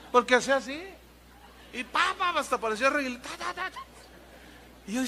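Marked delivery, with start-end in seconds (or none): 1.7-3.8 high imitated slap sounds; slapping noises. Preacher imitates the slaps he received, high pitched. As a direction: smack out each syllable like a slap.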